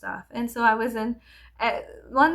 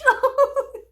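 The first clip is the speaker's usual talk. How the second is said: bursting loud laugh; 10 dB louder than usual. Real, not acted. Laughing at her own joke about the teacher, loud. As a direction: crack up at yourself.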